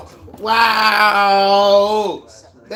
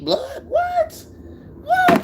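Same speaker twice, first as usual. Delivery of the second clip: laughing, then much higher squeaky what; shocked squeal; 5 dB quieter, slower than usual. Real, not acted. Streamer reacting to Tank being shot, high shocked squeals. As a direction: squeak out your disbelief.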